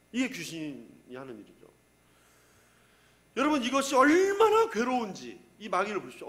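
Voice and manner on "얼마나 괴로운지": "얼마나" drawn out high and loud; emphatic anguish. Real, not acted. Preacher shows the congregation the demon's torment, drawn-out stress on "얼마나". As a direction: stretch and push "얼마나" with force.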